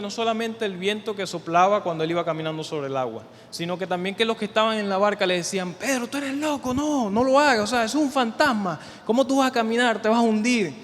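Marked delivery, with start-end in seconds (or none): 5.7-10.7 higher, louder taunting from the boat; mocking disbelief. Disciples in the boat to Peter, higher taunting disbelief. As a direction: scoff at him like doubting friends.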